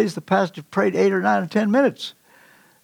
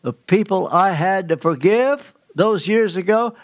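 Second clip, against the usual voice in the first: normal talking voice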